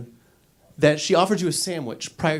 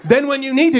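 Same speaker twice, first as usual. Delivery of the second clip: ordinary talk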